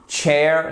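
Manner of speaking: "Chair" with a "ch" as in church; English chair. Shown as a demonstration. Teacher shows English chair versus French chair. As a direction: say "Chair" with a hard "tch" start. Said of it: "c'est évidemment la chaise".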